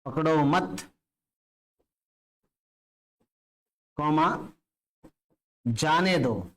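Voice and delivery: "पकड़ो मत", long pause, then "जाने दो"; comma after मत. Shown as a demonstration. Teacher dictating the comma sentence to students, pausing after "मत". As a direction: break clearly between "मत" and "जाने". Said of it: "थोड़ा सा रुक के बोलना है".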